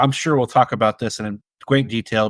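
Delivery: ordinary talk, nothing marked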